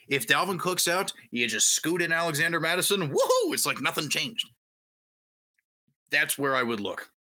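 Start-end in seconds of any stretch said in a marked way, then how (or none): 3.1-3.5 excited cheer. Real, not acted. Cheering a plug-and-play back.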